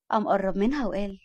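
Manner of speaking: ordinary delivery, nothing marked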